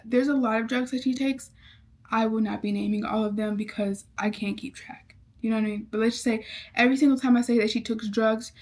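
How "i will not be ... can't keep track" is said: whispered confession to the viewers; whispered aside. Real, not acted. Whispering an aside to viewers about the drugs. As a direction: drop to a whisper, half joking.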